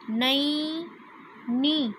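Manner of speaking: Naini drawn out slowly; slow sounding out. Shown as a demonstration. Teacher sounding out half a word slowly. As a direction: stretch Naini for the class. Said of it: "पहले आप आधा पढ़ेंगे".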